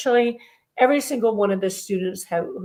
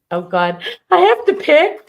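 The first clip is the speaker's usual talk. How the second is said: excited; 8 semitones higher, 8 dB louder than usual. Real, not acted. Drawing winner, excited squeal.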